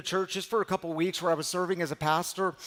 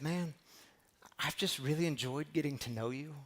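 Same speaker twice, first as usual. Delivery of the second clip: gentle retelling; 9 dB quieter than usual. Retold or imitated. Friend's kind words retold.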